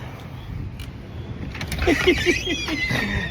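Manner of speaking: silent wheezing laugh; unvoiced high laugh; breathy laugh. Real, not acted. Laughing at doorbell mix-up.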